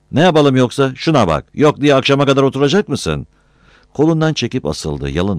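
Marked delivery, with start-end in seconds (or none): 0.1-3.3 loud, high exasperated questioning; irritated scolding. A narrator's reading. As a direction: tell him off for stalling. Boss scolds in acted quarrel.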